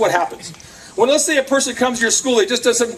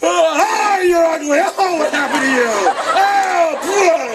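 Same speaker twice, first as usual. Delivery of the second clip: mock morning insult; 6 semitones higher, slower than usual. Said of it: "and you go".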